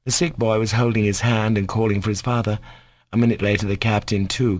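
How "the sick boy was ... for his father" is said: ordinary delivery, nothing marked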